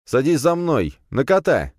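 high loud call; shouting. Acted. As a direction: shout the order. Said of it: "крикнул я".

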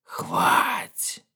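very high, hissing whispered shriek; the creature screeches as it grabs him. A narrator's reading. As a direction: screech it like a pouncing ghoul. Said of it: "провизжала".

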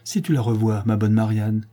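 ordinary delivery

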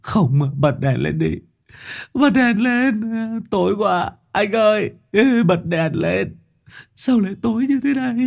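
very high, frantic cries; panicked pleading. A narrator's reading. Captive in the dark begging the jailer, terrified. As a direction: beg for the light in terror.

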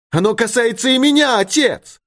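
very high impassioned protest; defiant daughter. A narrator's reading. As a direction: stand up to the father in a high cry. Daughter defies her father. (пылкое возражение дочери высоким голосом)

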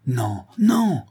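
a sharp cry of refusal. A narrator's reading. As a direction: cry no, no in protest. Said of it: "cria-t-elle". (crier non)

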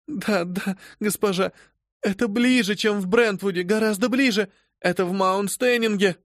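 high, urgent frightened pleading; alarmed. Acted. Servant insisting to her mistress the fire is near. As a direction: insist with rising panic.

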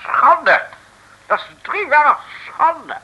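high, scandalised cry of shame; hammed-up moral outrage; outraged. Mayor outraged at betrayal. (hoge, verontwaardigde uitroep)

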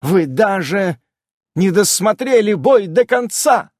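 loud, scornful reproach; contemptuous. A narrator's reading. Amazon scorning the spirits, loud and contemptuous. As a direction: sneer it at them. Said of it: "с презрением сказала она".

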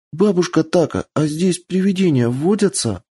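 put-on high, wondering child voice; curious little girl. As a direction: ask in hushed wonder. Child asks about ghosts.

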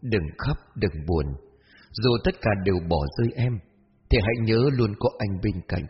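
soft, soothing reassurance; gentle young man, comforting. Acted. Mysterious young man comforting lonely Hoa, soft and tender. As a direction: comfort them quietly, in an unhurried voice.

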